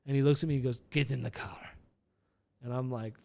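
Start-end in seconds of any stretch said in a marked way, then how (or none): 0.9-1.6 imitating a Russian driver's terse order; lightly put-on Russian accent. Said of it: "He's like a Russian guy".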